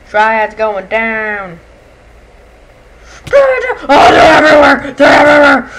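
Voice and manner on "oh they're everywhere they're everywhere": very loud alarmed yelling; panicked shout. Real, not acted. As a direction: scream as enemies swarm. Swarmed by enemies.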